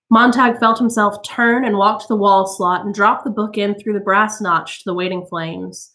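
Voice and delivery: usual speaking voice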